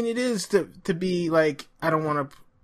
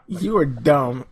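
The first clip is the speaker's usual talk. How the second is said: ordinary delivery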